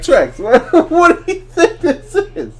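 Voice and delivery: high, disbelieving laugh; laughing what do you think this is; incredulous. Retold or imitated. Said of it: "I'm like".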